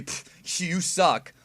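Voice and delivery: mock scoff, then a punched jeer; dismissive heckler. Somebody putting down a self-actualized person, dismissive.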